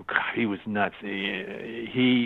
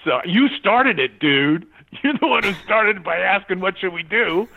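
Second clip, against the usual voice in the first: mock accusing; 8 semitones higher than usual. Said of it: "quoted retort".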